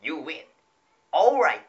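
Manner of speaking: loud, high arcade victory call; fighting game announcer. Shown as a demonstration. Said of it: "細かすぎて伝わらないモノマネ".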